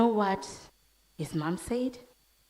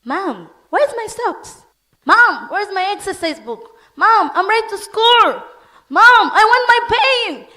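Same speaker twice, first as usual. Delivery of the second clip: put-on high, loud children calling Mom; kids shouting for mom; 19 dB louder than usual. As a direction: yell like impatient kids wanting things. Kids calling mom all at once.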